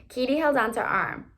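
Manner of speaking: ordinary delivery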